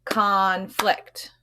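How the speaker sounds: conflict split into two clapped beats, CON-flict; clapped syllables. Shown as a demonstration. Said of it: "let's clap it".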